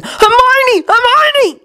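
high, desperate calling; frantic shouting. A narrator's reading. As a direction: call out her name in panic. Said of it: "but Ron did not listen".

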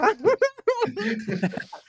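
high burst of laughter; sudden high laugh. Real, not acted. Друзья дразнят.

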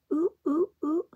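high, loud monkey hoots. Acted. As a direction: hoot like a playful monkey. Monkeys' call in story.

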